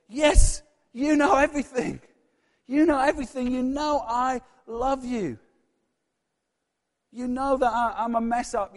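high, loud, broken confession as Peter; pleading Peter. Acted. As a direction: cry out every failure to Jesus, begging to be believed. Voices Peter confessing to Jesus.

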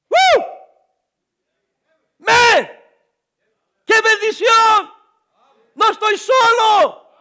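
high ringing shouts of praise, slow and loud; joyful shout. Real, not acted. Preacher bursting into praise before the congregation, shouting. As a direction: shout it out with joy. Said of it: "me dan ganas hasta de gritar".

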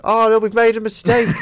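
high, loud, laughing mock politician; mock dismay. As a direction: squeal it out as a panicked prime minister. Imagined Theresa May reaction.

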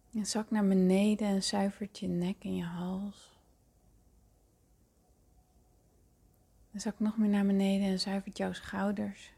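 hushed and calm, breathy at times; soft spa whisper. Acted. Leads a guided meditation.

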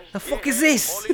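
high, soft disbelieving sneer; high mock disdain; mock disgust. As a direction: sneer at the sweater. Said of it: "They probably gave him a sweater".

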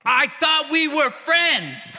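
high, slow, whiny plea. Acted. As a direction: whine it like a hurt friend. Saul hurt by Samuel.

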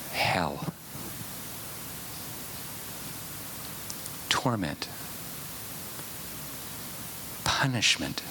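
soft, slow, half-whispered list; hushed. Real, not acted. Preacher confronting the congregation, hushed and whispered. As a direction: let it sink in, barely above a whisper.

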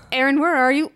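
wife phoning her missing husband, worried and loud; worried call. Retold or imitated. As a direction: call him, half frantic. Wife retells frantic phone call.

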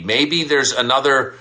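normal talking voice

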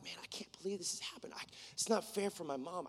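very soft, near-whispered protest; hushed, understated hurt; retold upset disbelief. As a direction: mutter it to yourself under your breath. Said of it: "I got angry".

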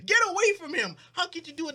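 high, loud horrified cry; horrified girl. Retold or imitated. Girl yelling at her friend in horror, high.

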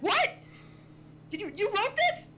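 high, loud disbelief; incredulous outburst. Retold or imitated. Friend learning who wrote the story, incredulous high cry. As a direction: blurt it out, voice shooting up.

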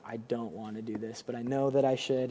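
soft, dreading inner voice; quiet, resigned reluctance; reluctant sigh. Retold or imitated.

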